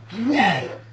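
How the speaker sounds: high shocked whoa; startled shout. Retold or imitated. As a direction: yell as if something grabbed you. Friend jumps out of tree.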